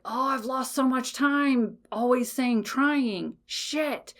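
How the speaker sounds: acted regret. Listener realising she has lost so much time, acted regret. Acts out regret when it hits.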